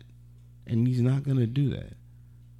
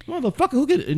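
high mock outburst; faster than usual. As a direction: pitch jumps way up in mock anger.

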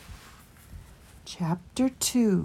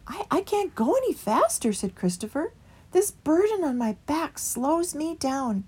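higher strained plea; weary Christopher; 9 semitones higher than usual. A narrator's reading. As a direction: labour under the load. Burdened Christopher.

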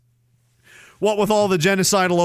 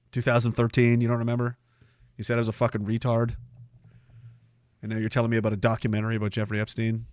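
ordinary delivery

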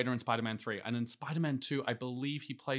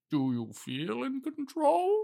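high, slow, drawn-out villain line, much higher; hammed-up Bane; 13 semitones higher, slower than usual. Correcting co-host's quote, slow high Bane parody. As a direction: camp slow menace as Bane. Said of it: "He says".

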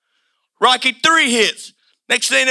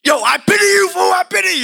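trash-talking boxer; 6 semitones higher than usual. Retold or imitated. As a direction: taunt him like a cocky rival fighter. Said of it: "you see this cat, Clover Lang, like".

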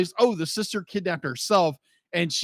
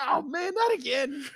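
dismayed groan; 8 semitones higher than usual. Retold or imitated. Viewer reacting to yet another injury, dismayed. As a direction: act out a weary "not again", pitched up.

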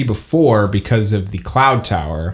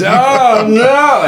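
high, louder oh no; elf's dismay; 13 semitones higher, 4 dB louder than usual. Acted. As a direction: cry out in high alarm. Elf reacts, GM voices him.

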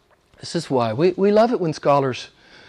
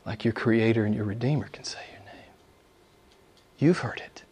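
whisper; 4 semitones lower, 10 dB quieter than usual. Real, not acted. Preacher whispers to congregation.

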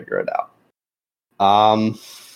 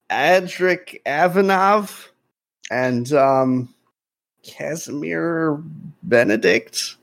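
put-on nervous young officer; 6 semitones higher, slower than usual. Young officer checks names.

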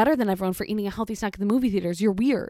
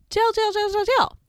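high-pitched, rapid-fire mock outrage; squeaky pretend condemnation; mock sentencing; 12 semitones higher, 4 dB louder than usual. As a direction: fire it off high like a comic verdict. Mock sentencing for eating a hot dog.

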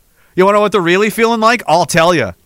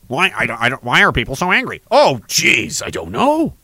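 lower, softer baffled mumble; mock clueless whine; 6 semitones lower, 3 dB quieter than usual. Mocking the party that asks why people are angry, mock baffled mumble. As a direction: sneer at someone playing dumb.

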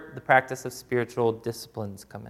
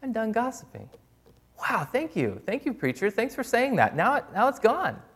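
high, softer, sing-song gratitude; mock instant convert; 9 semitones higher, 3 dB quieter than usual. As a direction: pretend to be cured on the spot, hammed-up. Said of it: "instantly go".

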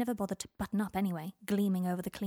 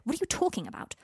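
demanding; 6 semitones higher, faster than usual. A narrator's reading. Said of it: "Harry demanded".

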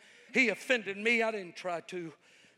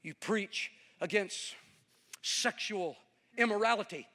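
ordinary delivery

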